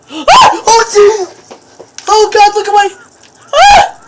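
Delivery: high, loud screams of terror; panicked screams. Real, not acted. Player attacked by the monster, panicked screams.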